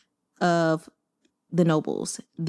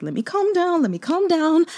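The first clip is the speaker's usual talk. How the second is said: mock self-calming; 10 semitones higher than usual. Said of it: "So I was like".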